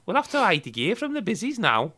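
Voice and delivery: Scouse accent, leaping high at the end; comic Scouser with a sing-song rise; sketch-show Scouse. Shown as a demonstration. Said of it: "You do sound like the Harry Enfield sketch".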